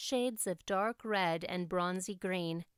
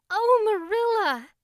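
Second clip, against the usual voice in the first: much higher and louder, a cry of pure delight; overjoyed cry; 13 semitones higher, 10 dB louder than usual. A narrator's reading. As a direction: shriek her joy at the good news. Anne overjoyed at permission.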